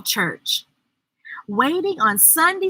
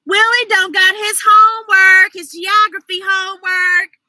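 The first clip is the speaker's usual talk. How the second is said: high, louder tattling; proud announcement; 7 semitones higher, 6 dB louder than usual. A narrator's reading. Libby tattling on Willie to the teacher, proud. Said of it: "Libby announced".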